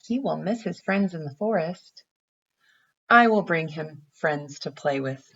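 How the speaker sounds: gentle storybook read-aloud; reading to children. Acted. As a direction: read it like a picture book for little kids. Teacher reads picture book aloud.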